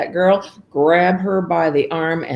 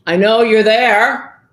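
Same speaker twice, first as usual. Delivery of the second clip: loud, high slow ogre growl; menacing ogre calling up; 6 semitones higher, 8 dB louder, slower than usual. Acted. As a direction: loom over her, slow and loud. Ogre confronts hidden girl.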